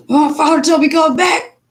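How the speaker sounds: put-on mother telling the kids off, sassy; sassy retort. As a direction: tell them off with a cheeky edge. Retold quip to children.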